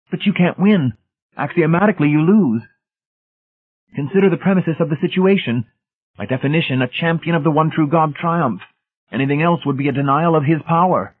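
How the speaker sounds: high, loud, insistent zealot voice; zealous Brady, certain. A narrator's reading. Brady arguing he cannot lose, certain. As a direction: pronounce the logic fervently, pitched right up.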